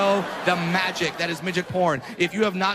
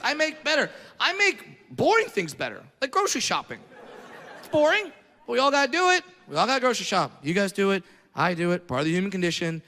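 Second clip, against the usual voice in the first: ordinary talk, nothing marked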